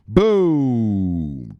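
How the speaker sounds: drawn-out loud boom falling away; long shouted boom. Acted. Streamer to viewers promising gains, a long loud boom. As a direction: let the boom ring out.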